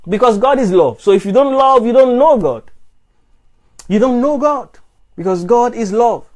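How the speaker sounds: high, loud preacher's challenge; fiery sermon voice; preaching at full force. Acted. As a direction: hammer the questions home to the congregation. Preaching the key verse.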